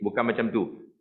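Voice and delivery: ordinary talk, nothing marked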